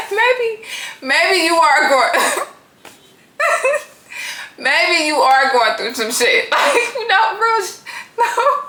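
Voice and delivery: high voice breaking into real laughter; cracking up. Real, not acted. Realising the woman may be struggling, cracking up. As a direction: try to speak through the giggles, stalling. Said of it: "I'm not trying to laugh at her".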